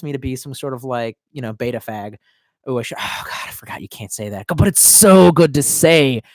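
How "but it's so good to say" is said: relishing. Real, not acted. Relishing a slur.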